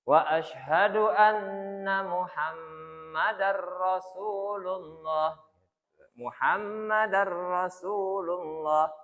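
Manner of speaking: "Muhammadar" with a clear a before Rasulullah, slow; careful recitation. Shown as a demonstration. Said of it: "Bukan Muhammadur". ("Muhammadar" dibaca jelas dan pelan)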